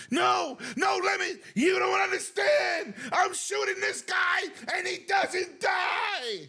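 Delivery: high, slow falsetto wail; hammed-up tearful shrieking; mock screaming panic. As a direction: imitate a hysterical gamer pleading his case. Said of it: "He's screaming".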